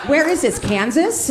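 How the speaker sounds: usual speaking voice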